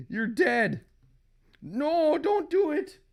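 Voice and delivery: high acted melodrama; desperate outcry. Comic couple's final confrontation, high and desperate.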